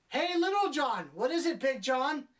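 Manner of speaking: high, loud, hammed-up pet-name exchange; mocking singsong call. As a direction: mimic two characters calling out, exaggeratedly high. Mocks characters calling each other.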